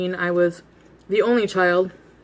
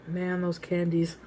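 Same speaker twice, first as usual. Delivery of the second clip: longing; 9 dB quieter, slower than usual. Retold or imitated. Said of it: "And I was just like".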